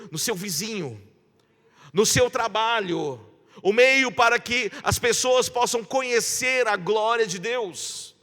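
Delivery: rising, fervent pulpit call; preaching. Acted. Pastor urging the congregation, in full preaching voice. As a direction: preach it, calling the church to act.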